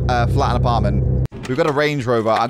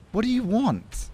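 much higher, lilting put-on voice; wary heroine; 10 semitones higher, 5 dB quieter than usual. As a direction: answer the stranger in a high voice. Reads heroine's reply.

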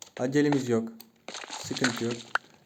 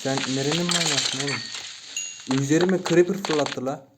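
raised, incredulous protest; indignant outburst. Real, not acted. Player scolding whoever threw a creeper, indignant. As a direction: protest in disbelief at the attacker.

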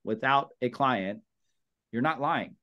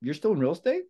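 higher, lightly voiced friend in disbelief; surprised friend; 5 semitones higher than usual. A friend on the phone, surprised you're still an agent, raised voice. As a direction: play the friend amazed you're still in real estate. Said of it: "And they'll say".

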